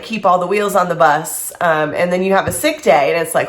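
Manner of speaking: ordinary delivery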